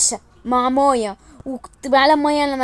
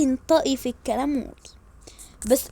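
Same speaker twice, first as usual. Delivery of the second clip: usual speaking voice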